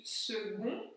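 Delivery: second dit à la française, le C comme un G: segond; French second. Shown as a demonstration. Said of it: "le C se prononce G". (second prononcé segond, le C devient G)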